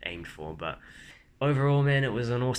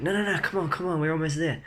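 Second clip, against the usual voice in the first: much higher, urgent cheering-on; urgent encouragement; 8 semitones higher, 4 dB louder than usual. Retold or imitated. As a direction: coax a flagging runner onward, pitched high. Urging on a stopped runner.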